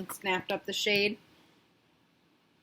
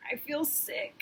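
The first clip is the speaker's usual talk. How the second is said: much softer, feeble voice; said weakly; 5 semitones higher, 10 dB quieter than usual. Acted. Sarah telling her dad she feels sick, weak and soft. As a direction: barely get the words out. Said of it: "Sarah said weakly".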